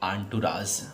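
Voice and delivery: आंटुराज as aan-too-raaj, French style; pronunciation demo. Shown as a demonstration. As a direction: say आंटुराज softly, not एंटोरेज. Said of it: "इसे हम लोग पढ़ेंगे बेटा". (आंटुराज का सही उच्चारण)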